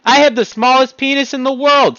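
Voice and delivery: high, loud mock proclamation; honest yell. Shown as a demonstration. Demonstrating an honest yell to the audience. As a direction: shout it like a proud confession. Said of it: "I can yell there".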